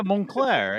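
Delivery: Montclair said the French way with no T; French pronunciation, silent T. Shown as a demonstration. Co-host correcting the reader's name, Montclair with silent T. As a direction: drop the T in Montclair, French style. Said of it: "the T is silent".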